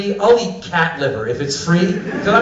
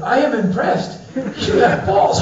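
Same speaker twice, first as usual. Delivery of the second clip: high-pitched, admiring mimicry; impressed stranger; 8 semitones higher, slower than usual. Iranian stranger admires a visiting American, impressed and high. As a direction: play the impressed local, drawing out the last words.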